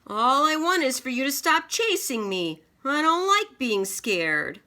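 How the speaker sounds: high loud dragon voice; dragon reply. Acted. Dragon replying loudly to the king. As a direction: scoff grandly as the big beast.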